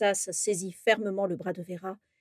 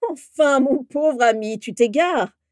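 loud, high-pitched derision; mocking; 5 semitones higher, 9 dB louder, slower than usual. A narrator's reading. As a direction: scoff loudly at a deluded friend. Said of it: "eut un grand rire moqueur". (moqueur, fort et aigu)

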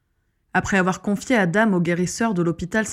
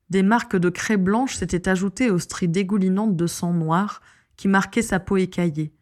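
usual speaking voice

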